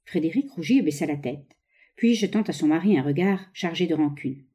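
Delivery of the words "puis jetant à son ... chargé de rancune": plain reading voice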